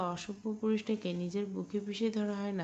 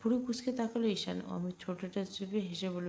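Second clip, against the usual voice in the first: plain reading voice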